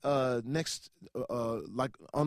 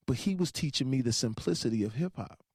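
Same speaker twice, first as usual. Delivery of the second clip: ordinary talk, nothing marked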